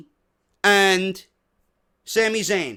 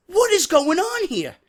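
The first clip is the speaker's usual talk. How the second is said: exasperated disbelief; 12 semitones higher than usual. Real, not acted. Ranting in disbelief at wrestler.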